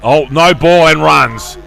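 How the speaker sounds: slow, loud announcement; loud call. Real, not acted. Umpire signals a no ball.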